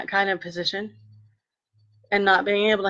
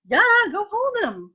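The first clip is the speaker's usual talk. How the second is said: much higher, slow, drawn-out permission; a slow, pitched-up, indulgent yes to her sister; indulgent go-ahead; 13 semitones higher, slower than usual. Retold or imitated. Retold reply to sister.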